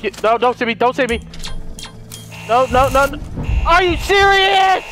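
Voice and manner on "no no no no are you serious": higher, louder desperate yells; frantic shouting. Real, not acted. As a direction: shout the monster away in panic. Fighting off the alien.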